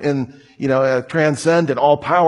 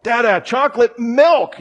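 imitated kid's insistent call, much higher pitch; mock-yell it like a toddler losing patience; high, agitated demand, playfully retold; agitated yelling child; 9 semitones higher than usual. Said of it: "he's getting really agitated".

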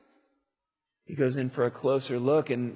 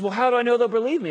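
worried Moses; 8 semitones higher, 5 dB louder, much faster than usual. Acted. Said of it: "Moses says".

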